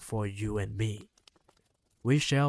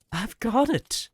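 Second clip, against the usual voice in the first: put-on high, loud boyish shout of discovery; excited shout; 11 semitones higher, 6 dB louder than usual. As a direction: burst out with the idea, yelling. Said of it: "I shouted".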